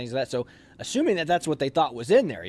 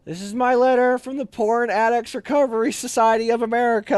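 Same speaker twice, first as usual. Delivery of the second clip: higher, louder fake-formal letter voice; mock solemn reading; 10 semitones higher, 6 dB louder than usual. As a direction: read it like an official pledge. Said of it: "it just says".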